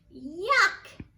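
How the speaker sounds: loud, high yell of disgust; yelled yuck. Acted. Teacher reading to kids, the yak yells in disgust. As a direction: shout it like a disgusted little yak. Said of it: "yelled the yak".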